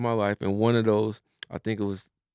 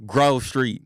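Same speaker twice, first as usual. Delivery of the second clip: CJ; 7 dB louder than usual. Retold or imitated. Quoting Grand Theft Auto to a friend, California gangster impression. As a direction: imitate a video game gangster coming home. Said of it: "You sound just like him".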